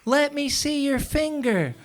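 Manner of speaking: very high, slow frantic plea; hammed-up tearful mother; mock crying mom. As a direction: play the panicked mom begging. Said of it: "screaming and crying".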